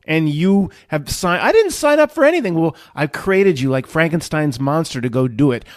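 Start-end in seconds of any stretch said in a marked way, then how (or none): 1.4-2.5 high, fast protest; protesting little soul. Acted. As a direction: object quickly in a high voice. Little Matt protests being sent.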